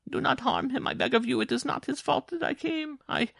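high, urgent woman's plea; imploring. A narrator's reading. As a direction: beg desperately until cut off. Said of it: "Jean Marie implored".

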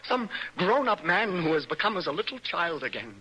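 ordinary delivery

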